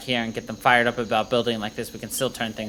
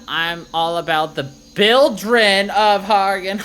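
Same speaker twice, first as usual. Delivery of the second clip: mocking; 7 semitones higher, 8 dB louder, much slower than usual. Character mangles the name mockingly.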